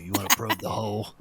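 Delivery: low, soft suggestive voice; different way, suggestive. Shown as a demonstration. Game master re-saying his question, low suggestive delivery. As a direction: drop it down low and quiet. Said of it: "I said it in a different way".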